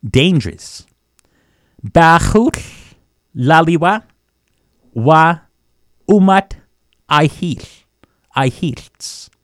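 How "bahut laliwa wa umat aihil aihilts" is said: Bahut, laliwa, wa, umat spaced out one at a time; word by word. Shown as a demonstration. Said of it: "This place we've got ourselves into is".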